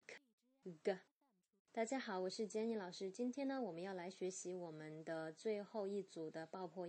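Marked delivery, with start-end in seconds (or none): 0.1-1.0 crisp k then voiced g; k and g sounds. Shown as a demonstration. Teacher modelling k and g for the class. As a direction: model the two stop sounds. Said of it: "the stop consonant".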